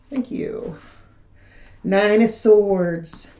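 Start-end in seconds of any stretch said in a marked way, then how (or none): 0.1-0.8 whispered thanks. Real, not acted. Thanking spirit guides softly.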